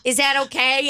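a hammed-up lost audience member; mocking clueless patron. Imitating lost patrons asking the comedian where to sit. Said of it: "they're about to ask Angela".

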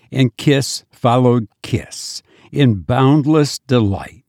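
plain reading voice

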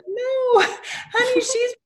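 very high, laughing and crying at once; half-sobbing, half-laughing, very high; laughing through tears. Retold or imitated.

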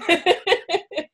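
high-pitched giggly burst; real, high laughter. Real, not acted. Host laughs launching the conversation.

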